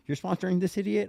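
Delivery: pitched well up in disbelief; incredulous lawyer. Retold or imitated. Lawyer, stunned that he sponsors his client, asks high and incredulous. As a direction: ask it as if you can't believe it.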